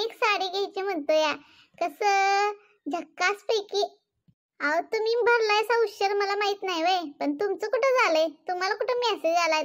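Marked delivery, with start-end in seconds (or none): 1.8-4.0 slow mocking echo of झकासपैकी. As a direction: drag out झकासपैकी to mock her. Mocking neighbour's word back. (झकासपैकी हा शब्द हळू, चिडवत उच्चारणे)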